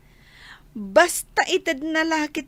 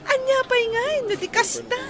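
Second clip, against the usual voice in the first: giggling; 10 semitones higher, faster than usual. Acted. Giggling friend in banter.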